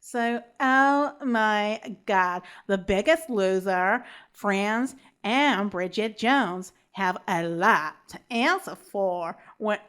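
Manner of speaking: badly faked American Valley Girl accent. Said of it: "in my other American accent, which is Valley Girl".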